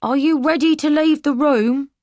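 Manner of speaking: high, loud but unsure soldier; timid soldier. Acted. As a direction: ask it nervously. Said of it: "A soldier's timid voice".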